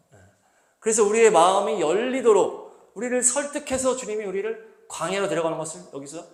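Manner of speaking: ordinary talk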